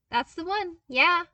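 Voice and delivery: put-on upbeat young voice, proud of his find; eager young man. A narrator's reading. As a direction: pitch it up like an excited young engineer. Luke's voice again.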